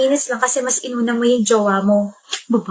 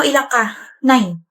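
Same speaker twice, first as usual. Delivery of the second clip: ordinary talk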